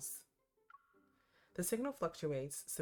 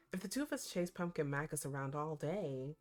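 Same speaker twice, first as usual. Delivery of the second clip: normal talking voice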